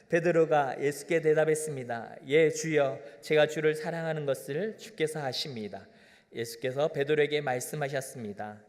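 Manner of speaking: calm, measured Bible reading; scripture reading. Shown as a demonstration. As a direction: read the verse aloud to the congregation. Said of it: "말씀 한번 읽어보고 싶습니다". (성경 낭독)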